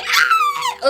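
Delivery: a very high shriek of surprise; a stunned cry when the guess proves right; startled shriek. Real, not acted. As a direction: shriek in sudden shock. Shocked by the confirmed surname.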